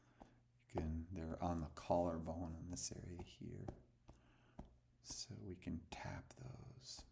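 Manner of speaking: gentle whispered guidance. Acted. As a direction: hush it to a soothing whisper. Soft guided instructions.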